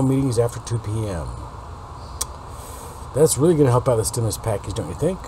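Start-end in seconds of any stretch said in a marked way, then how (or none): none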